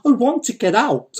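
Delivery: high, desperate yell. Acted. Uncle Dima trapped in the jar, yelling. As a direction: wail the demand at the top of your voice. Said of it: "yelled Uncle Dima".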